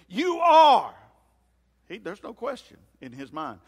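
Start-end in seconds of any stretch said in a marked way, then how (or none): 0.1-0.9 loud, high, emphatic declaration; piping up boldly. Acted. Preacher acting out Peter blurting his answer to Jesus, piping up loudly. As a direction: declare it with total conviction, voice raised. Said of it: "he pipes up and he says".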